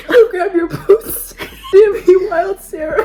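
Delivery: high, loud, slow words through laughter; real laughing fit; cracking up. Real, not acted. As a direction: laugh helplessly while talking. Cracking up over the boot joke.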